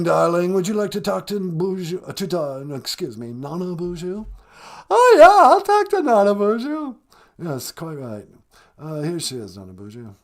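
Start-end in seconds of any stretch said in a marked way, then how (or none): 4.9-7.0 much higher, louder, gushing caricature of Megan; mock Megan voice. Host plays Megan on the phone, high gushing squeal. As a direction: squeal it like an overexcited fan.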